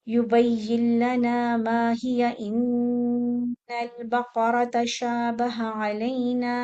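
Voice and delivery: drawn-out chanted Quranic recitation; slow recitation. Shown as a demonstration. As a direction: chant it slowly and evenly. Recites verse.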